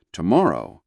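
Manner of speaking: plain reading voice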